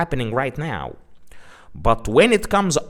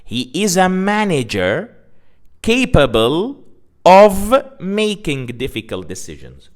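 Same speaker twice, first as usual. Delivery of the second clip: louder, deliberate repeat of the example; careful teacher repeat, a bit louder; example read again with emphasis; 4 semitones higher, 4 dB louder than usual. Shown as a demonstration. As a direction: lean into each word the second time round. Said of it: "Let me say the example one more time".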